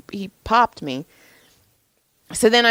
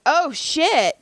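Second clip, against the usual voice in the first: retold alarm; 10 semitones higher than usual. Acts out alarm at the dog.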